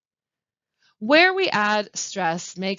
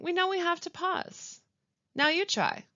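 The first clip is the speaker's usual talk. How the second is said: ordinary delivery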